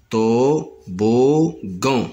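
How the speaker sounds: toboggan drawn out slowly; syllable counting. Shown as a demonstration. Teacher counting toboggan's syllables for pupils. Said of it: "Un, deux et trois".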